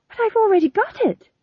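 desperate high-pitched protest. A narrator's reading. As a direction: protest with the voice shooting up. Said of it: "said Tom John desperately".

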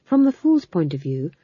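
usual speaking voice

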